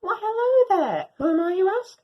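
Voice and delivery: high, bright greeting as Belle the dog; a dog introducing herself; playful dog voice. Acted. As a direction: greet the audience as a cheeky dog. Dog narrator greets listener.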